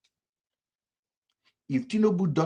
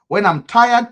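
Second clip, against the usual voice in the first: emphatic burst; 10 semitones higher, 10 dB louder, slower than usual. Real, not acted. Boasting about freedom to drive.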